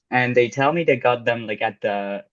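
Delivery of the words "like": normal talking voice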